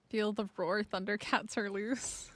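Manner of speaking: a half-hearted mighty-beast boast, low-key; mock fierce. Streamer boasting to chat like Thundercats, low-key mock fierceness. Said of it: "This is cringier than Battle Cat".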